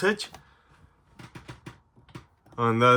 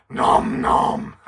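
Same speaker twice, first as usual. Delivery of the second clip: munching creature voice; 3 dB louder than usual. Acted. Puppet Stripe answers.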